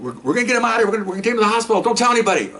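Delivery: high, hurried voice of panicking cops; urgent panic. Retold or imitated. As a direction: blurt it out in a rush of alarm. Colleagues panicking after the shooting.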